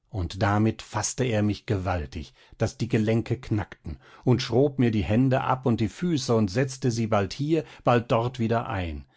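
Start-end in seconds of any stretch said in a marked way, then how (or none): none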